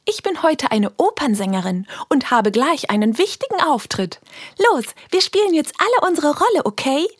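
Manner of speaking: put-on girl rallying friends to play; eager little girl. Helene announces the dress-up game. (höhere Mädchenstimme, zum Schluss schnell und begeistert)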